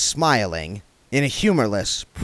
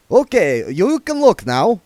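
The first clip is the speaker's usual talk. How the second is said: put-on vampire accent; 11 semitones higher, 7 dB louder than usual. A narrator's reading. Otto voiced.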